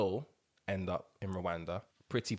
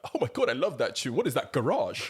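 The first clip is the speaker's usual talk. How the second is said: fast, higher mock raver; imitating the gif; 4 semitones higher, 4 dB louder than usual. Quoting a viral gif, mock gushing. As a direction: gush like the old meme. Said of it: "that gif that used to fly around".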